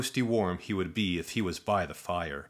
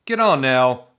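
louder, swatting annoyance; shooing; 5 semitones higher, 10 dB louder than usual. Acted. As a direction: shoo it off loudly. Shooing the buzzing bug.

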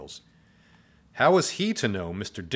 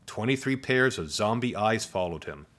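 ordinary delivery

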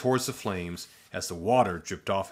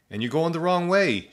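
higher, a little louder, helpful correction; high zombie voice; 8 semitones higher, 4 dB louder than usual. Acted. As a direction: add it brightly, way up high. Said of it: "she added".

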